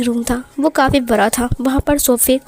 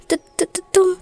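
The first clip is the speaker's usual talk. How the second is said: frightened stammer; 6 semitones higher than usual. Acted. Scared omega stammers at the angry mafia boss with the gun. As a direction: stutter out the word in panic. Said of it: "वांग जी इस बार काफ़ी ज़्यादा घबरा गया।".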